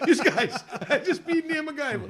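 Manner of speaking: high, loud rush of news; re-enacted breathless-to-tell announcement; excited retelling. Storms back to booth with news.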